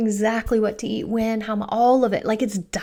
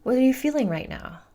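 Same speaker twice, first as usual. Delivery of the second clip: higher-pitched, gently curious parent; 4 semitones higher than usual. Retold or imitated. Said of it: "It's curious".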